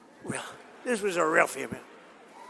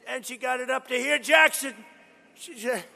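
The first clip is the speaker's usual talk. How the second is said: normal talking voice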